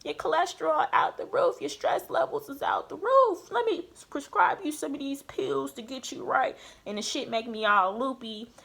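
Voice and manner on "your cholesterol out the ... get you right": higher mock doctor, breezy diagnosis; imitating doctor. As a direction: play the doctor, higher and matter-of-fact. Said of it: "He gonna pull back my labs and see".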